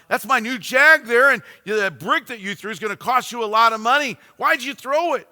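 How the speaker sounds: high, loud, heated tirade; building anger. Retold or imitated. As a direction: let the anger keep rising. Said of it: "Building up a head of steam".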